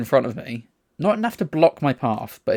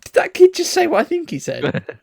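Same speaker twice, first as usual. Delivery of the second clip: high-pitched incredulous question; mock disbelief; 3 dB louder than usual. Telling how they asked their companion about the kid, incredulous. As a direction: replay the double take, pitched right up. Retelling disbelief at kid's remark.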